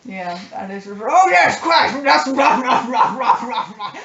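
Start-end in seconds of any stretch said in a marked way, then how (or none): 1.0-3.9 loud, high, hammed-up old English gentleman with guffaws; posh old English hunter. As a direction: boom it out like a cigar-puffing walrus-moustached hunter. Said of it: "we just start talking like that".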